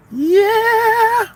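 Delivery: high, loud cheer; excited yell. Real, not acted. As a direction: cheer it out to the chat.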